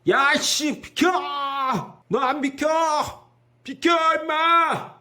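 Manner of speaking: mock road-rage yell, pitched high; acted angry yelling. Honking driver ordering the car ahead to move, angry mock yell. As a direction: yell like an impatient driver, last words drawn out high. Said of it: "비키라고".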